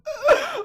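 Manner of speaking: loud, very high burst of laughter. Real, not acted. Vlogger cracking up at an old meme, laughing. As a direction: burst out laughing at your own joke.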